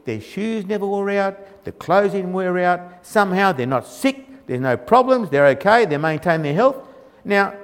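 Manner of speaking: ordinary talk, nothing marked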